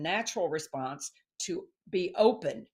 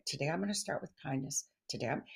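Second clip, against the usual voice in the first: soft, murmured resolution to oneself; quiet self-reminder; 3 semitones lower, 8 dB quieter, faster than usual. Pretend. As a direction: say it softly, like a private daily promise. Reciting a daily resolution to oneself.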